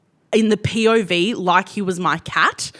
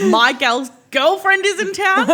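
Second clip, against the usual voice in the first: put-on London accent; 3 semitones higher than usual. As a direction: announce it in a playful mock London accent. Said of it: "Time to honour the London accent".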